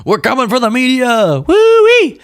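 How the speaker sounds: rising to a very high, loud woo-wee; mock Patel whoop. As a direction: ham it up, ending in a gleeful whoop. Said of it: "Kash Patel clip of him with Steve Bannon going".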